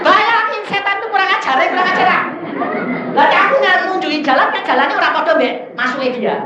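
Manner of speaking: high, loud indignant complaint; loud comic indignation; exasperated outburst. Real, not acted. Exclaims at the devil's cheek.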